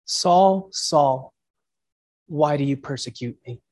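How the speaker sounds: voice of Jesus. Acted. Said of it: "that's how that voice spoke".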